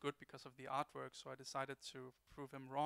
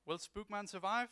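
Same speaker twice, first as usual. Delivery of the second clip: cliffhanger announcer; 6 semitones higher, 5 dB louder than usual. Acted. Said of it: "I'm gonna dub it for you".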